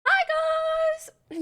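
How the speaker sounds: high-pitched, drawn-out squeal; squealed greeting. Real, not acted. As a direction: squeal hello at your fans.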